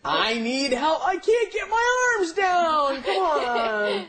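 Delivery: slow, hammed-up wail rising to a high shout; pretend-panicked drawn-out begging; mock desperate plea. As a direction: whine and holler as if comically stuck. Comic skit, stuck in a shirt.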